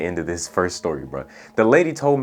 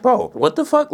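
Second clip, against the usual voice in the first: high, louder disbelieving bro what the fuck; shocked outburst; 4 dB louder than usual. Retold or imitated. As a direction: blurt it out high and stunned. Baffled reaction to teacher.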